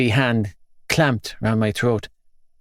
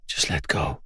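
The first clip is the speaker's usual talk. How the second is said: hushed low murmur from inside his head; inner voice urging; 8 semitones lower, 8 dB quieter than usual. A narrator's reading. Voice in his head urging him, low and soft. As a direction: softly coax him to give up. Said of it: "a voice in my head urged".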